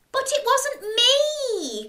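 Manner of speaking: high, slow, pleading protest with a laugh; Jack protesting. Acted. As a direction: plead your innocence, wide-eyed. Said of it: "cried Jack".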